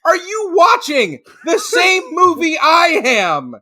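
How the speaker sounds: angry fan answering the audience; indignant shout. Retold or imitated. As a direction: fire it back at the room. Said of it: "And I went".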